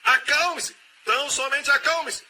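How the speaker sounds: high, loud "Acalme-se" called out; pleading high cry of "Acalme-se"; urgent command. Acted. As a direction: insist they be still. Repeating command, rising intensity.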